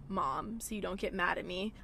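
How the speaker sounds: usual speaking voice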